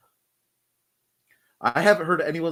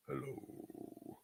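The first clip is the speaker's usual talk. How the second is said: whispered Lurch try; 2 semitones lower, 25 dB quieter than usual. Pretend. Trying a Lurch hello, whispered. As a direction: breathe it out, barely voiced. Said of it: "I can't do it".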